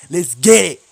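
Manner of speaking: loud, slow pump-up call; hype shout. Real, not acted. Gamer pumping himself up for the match. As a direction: drag it out loud to hype yourself.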